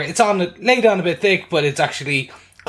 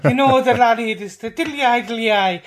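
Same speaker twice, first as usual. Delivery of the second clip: put-on stage Irish accent; 7 semitones higher, 3 dB louder than usual. Podcaster mocking TV Irish in a hammed-up Irish accent. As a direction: ham up a cartoon Irish accent. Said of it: "when the Irish are portrayed on American TV".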